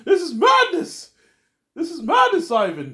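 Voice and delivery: high, loud cry with MADNESS stretched out; slow, hammed-up yell of disbelief; mock outraged shout. Telling Ivan this is madness, shouting in outrage. Acted outburst at Ivan continues.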